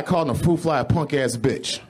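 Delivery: ordinary talk, nothing marked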